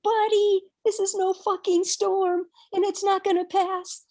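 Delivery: high, loud mock-angry shout at the teacher; furious retort. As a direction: blast it back at him, fuming. Swearing back at the teacher.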